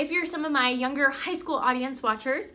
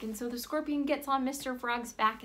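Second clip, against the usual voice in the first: ordinary delivery, nothing marked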